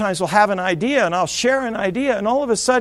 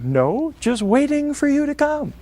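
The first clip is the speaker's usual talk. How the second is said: sarcastic reply; 7 semitones higher than usual. Retold or imitated. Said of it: "I don't say it in that tone".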